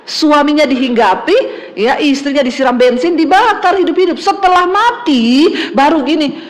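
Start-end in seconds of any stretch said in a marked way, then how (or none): none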